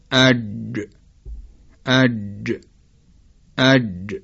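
isolated "اج", jim articulated ahead of shin; common reciters' jim. Shown as a demonstration. Teacher showing how many reciters say jim, isolated "اج". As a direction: repeat "اج" separately, vowel then a released j.

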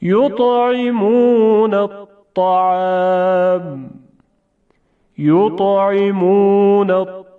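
slow, drawn-out recitation, heavy emphatic ط; Quranic recitation of examples. Shown as a demonstration. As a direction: recite each word slowly with a thick ط. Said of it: "حالا چند تا مثال".

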